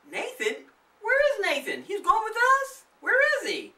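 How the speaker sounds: high, loud surprised calling; puzzled kids. Acted. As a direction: kids hunting for their friend. Kids looking for Nathan.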